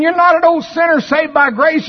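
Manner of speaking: high, loud, stress on "not"; shouted preaching. Real, not acted. Preacher shouts to the congregation. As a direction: lift and shout it.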